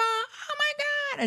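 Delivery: very high, slow, breathless amazement; stunned gasp. Retold or imitated. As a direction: gasp it out like a shocked grandma. Grandma reacting to the surprise.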